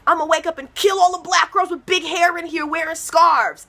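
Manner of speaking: high, loud sinister killer voice; menacing killer voice. Pretend. Comic telling the crowd what a killer might think, sinister voice.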